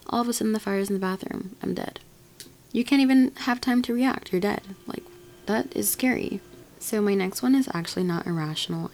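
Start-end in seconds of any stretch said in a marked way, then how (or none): none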